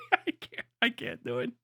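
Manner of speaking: breaking up with laughter; laughing. Real, not acted. Streamer failing to keep up an impression, laughing. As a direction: give up through your own laughter.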